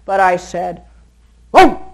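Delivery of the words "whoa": loud, low, quick whoa; sharp whoa. Shown as a demonstration. Trainer stopping a charging dog: loud low whoa. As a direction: snap it out low and fast to stop the dog. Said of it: "Low and quick".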